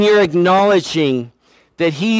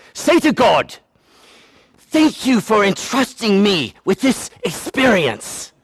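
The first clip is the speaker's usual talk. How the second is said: hammed-up English accent, higher pitched; 3 semitones higher than usual. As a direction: imitate an English lady with a mock accent. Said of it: "because she's from England".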